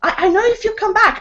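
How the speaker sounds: high, loud shout down the street; shouting at the fleeing leaflet man; yelling after him. Retold or imitated. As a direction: yell after him as he runs off. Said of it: "And I was going".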